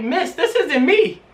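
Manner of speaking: high-pitched, stunned correction to the desk lady; confused protest. Retold or imitated. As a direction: point out the mix-up in disbelief. Handed someone else's test paper.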